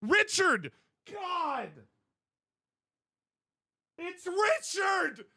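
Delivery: high, loud, stretched-out cries of despair; mock anguished shouting. Acting out the Vine for a friend, hammed-up anguish. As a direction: wail it like a man losing his mind. Reenacting the Richard Vine outburst.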